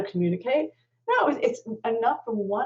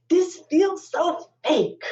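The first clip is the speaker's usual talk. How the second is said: doubting wife; 11 semitones higher, 4 dB louder, much slower than usual. Retold or imitated. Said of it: "she says".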